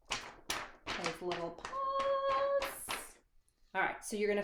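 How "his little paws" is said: much higher, very slow, cooing over tiny paws. Real, not acted. Teacher pointing to the otter's little paws, cooing slowly.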